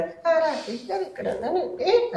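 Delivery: very high, slow mimicry of the actress; imitated small voice. Director imitating his actress calling out to him, in a small high voice. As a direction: stretch it out in a tiny squeaky put-on voice. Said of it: "చిన్న voice తీసుకొని".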